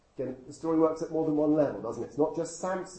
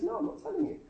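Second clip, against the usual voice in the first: much higher, singsong mock refusal; stubborn refusal; faster than usual. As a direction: tease it like a stubborn child. Repeated refusal to nagging wife.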